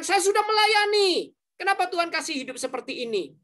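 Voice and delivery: whiny complaint. Retold or imitated. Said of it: "Jangan kamu katakan".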